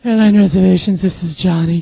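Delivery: smooth, unruffled phone greeting. Retold or imitated. Co-worker coolly answering a call, smooth. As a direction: answer smoothly at an easy pitch, projecting. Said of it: "he's so freaking smooth".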